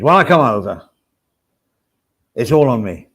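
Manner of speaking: loud impression, fading off at sentence ends. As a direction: punch it out, then let the ending fall away. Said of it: "That's my impression of Oz".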